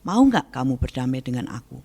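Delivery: ordinary talk, nothing marked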